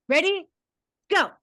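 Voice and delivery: ordinary delivery, nothing marked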